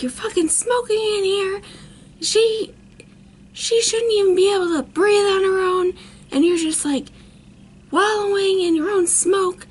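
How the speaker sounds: high, loud scolding rant; retold screaming. Dad berating boyfriend for smoking near her, retold screaming. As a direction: re-enact an outraged dad, voice pushed up high.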